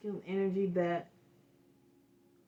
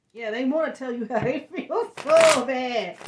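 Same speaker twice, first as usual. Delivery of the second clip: real tearful laughter, higher and much louder; emotional; 4 semitones higher, 10 dB louder than usual. Real, not acted. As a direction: voice overflowing, half laughing, half crying. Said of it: "I'm getting emotional".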